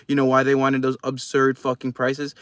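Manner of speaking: ordinary talk, nothing marked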